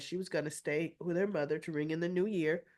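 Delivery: ordinary delivery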